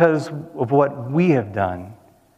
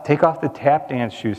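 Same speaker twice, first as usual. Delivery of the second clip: ordinary talk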